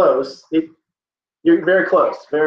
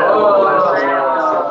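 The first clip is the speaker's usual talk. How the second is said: Spanish accent; 3 dB louder than usual. Shown as a demonstration. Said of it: "I just said it in Spanish".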